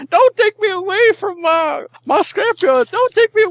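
put-on high, loud, pleading old prospector; gold miner voice. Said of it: "you can do your genuine gold miner voice".

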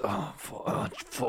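very soft, gasping mock moans; breathless comic moaning; mock climax moan. Shown as a demonstration. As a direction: act out a quiet climax. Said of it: "I'll do mine if you want".

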